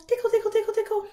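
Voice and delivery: high, loud playful tickle; gleeful tickle squeal. Shown as a demonstration. Teacher playing tickle game with kids, high gleeful squeal.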